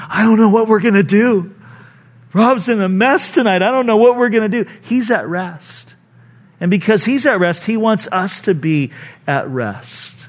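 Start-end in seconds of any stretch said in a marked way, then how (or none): 0.1-4.7 higher, fretful hand-wringing voice, lightly hammed; mock fretting. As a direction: play a panicked worrier, half in jest. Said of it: "wringing his hands going".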